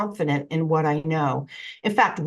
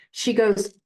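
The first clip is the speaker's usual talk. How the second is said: ordinary talk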